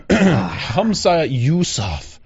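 name drawn out slowly; slow mocking drawl. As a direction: stretch the name out with scorn.

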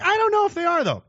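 very high, sarcastic reply; mock doubt. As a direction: answer with mock innocent doubt. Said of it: "And I'm like".

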